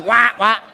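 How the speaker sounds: mock someone with duck quacks; duck quacking. Speaker mocking the agent as a duck, quacking. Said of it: "I can see the bill getting longer".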